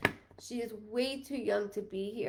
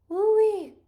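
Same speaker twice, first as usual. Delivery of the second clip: high, loud woo-wee; excited whoop; 11 semitones higher, 10 dB louder than usual. Real, not acted. Psychic whooping as a sign lands for viewers. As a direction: let it burst out in delight. Excited exclamation mid-reading.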